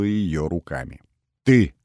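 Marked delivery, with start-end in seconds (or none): 1.4-1.7 короткий громкий жёсткий выпад; бросить обвинение жёстко и громко. A narrator's reading. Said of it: "жёстко ответила Дана". (жёстко, в упор)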